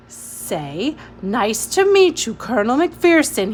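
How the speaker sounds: a touch of a commanding colonel; ordering. Acted. Colonel ordering Ada how to greet him, a hint of command. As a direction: order it crisply, lightly voiced old soldier. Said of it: "he ordered".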